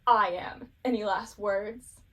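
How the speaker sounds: loud, imposing mock villain entrance; booming voice. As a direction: boom it out slowly across a forest. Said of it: "we hear a booming voice from the forest go".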